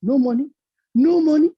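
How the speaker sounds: the people grow thrilled; excited villagers. Acted. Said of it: "And the people".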